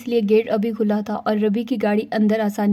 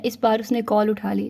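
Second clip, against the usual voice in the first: plain reading voice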